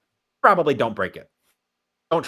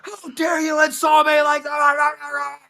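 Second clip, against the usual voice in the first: very high, slow hammed-up shout; outraged villain yell; mock enraged shriek; furious Jogo; 13 semitones higher, 5 dB louder, slower than usual. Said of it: "He's like".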